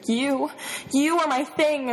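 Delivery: high, restrained outburst; mild inner scream. A narrator's reading. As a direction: cry it out high without real volume. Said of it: "my subconscious is screaming".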